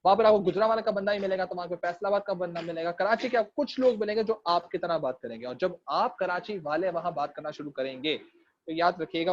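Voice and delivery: ordinary talk, nothing marked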